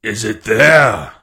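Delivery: loud, slow roared question. A narrator's reading. Blind pirate to man at the window, slow roar. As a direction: roar it, dragging out the words. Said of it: "roared Pew".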